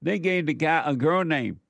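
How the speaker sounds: ordinary delivery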